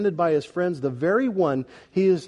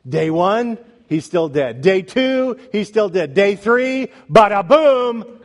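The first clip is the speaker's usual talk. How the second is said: preacher's count building to a loud, high climax; triumphant build-up; 4 semitones higher, 6 dB louder, slower than usual. Real, not acted. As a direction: build day by day, then explode on "bada boom".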